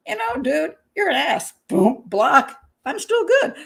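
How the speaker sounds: dismissive brush-off. Retold or imitated. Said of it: "instead of saying".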